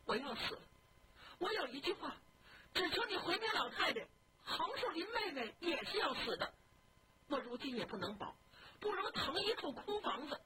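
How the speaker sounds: high, pleading, close to sobbing; crying. Acted. Dying young man begging his maid, crying. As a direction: beg through tears in a high voice. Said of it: "就哭着说".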